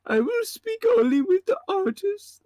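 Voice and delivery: very high, strained character voice; game character voice. Acted. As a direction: voice the client high and pinched. Voicing game character dialogue.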